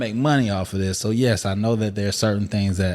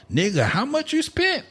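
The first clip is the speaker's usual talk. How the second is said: high put-on voice. Mock defiant retort.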